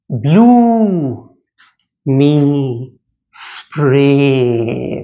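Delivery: "Oh, night, ting, gal, That on yon blue me spray" chanted in drawn-out syllables with long gaps; dragged stresses. Shown as a demonstration. Teacher demonstrating stressed syllables, "Oh, night, ting, gal, That on yon blue me spray" dragged long. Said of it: "drag long, that is stressed".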